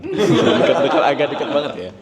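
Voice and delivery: high, quick, laughing protest. Real, not acted. Actor jokes about the seating being too close, laughing.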